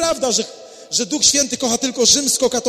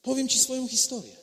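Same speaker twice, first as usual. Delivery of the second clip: soft, gentle reassurance; calming; 8 dB quieter than usual. Acted. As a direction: soothe a distressed man softly. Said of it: "A Piotr mówi".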